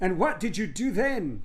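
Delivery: put-on high, slow, swooping boy voice; questioning boy. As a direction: pipe up as a curious child.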